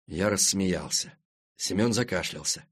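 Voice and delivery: ordinary delivery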